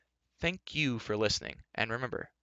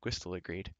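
plain reading voice

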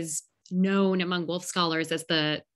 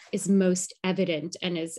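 ordinary talk, nothing marked

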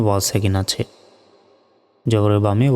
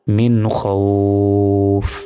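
খৌফ with "ow" held very long; stretched at the stop; much slower than usual. Shown as a demonstration. Teacher showing a stop, মিন খৌফ with long-held "ow". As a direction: draw out the glide in খৌফ, then close on f. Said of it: "এখানে কেন টেনে পড়লাম বলুন তো".

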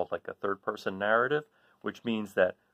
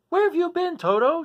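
high, louder worried cry; 9 dB louder than usual. Acted. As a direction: call out to the dog in relief. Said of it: "she cried".

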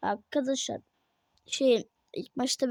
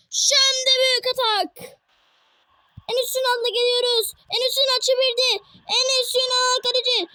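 higher, energetic play-by-play; football commentator; 7 semitones higher, 5 dB louder, slower than usual. Acted. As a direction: narrate every attack like live TV. Calls the match play-by-play.

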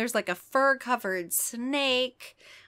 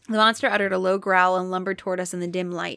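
usual speaking voice

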